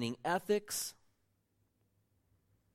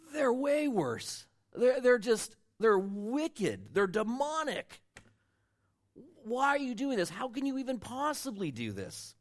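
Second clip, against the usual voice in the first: outraged; 9 semitones higher, 5 dB louder than usual. Retold or imitated. Voicing Habakkuk's outraged complaint.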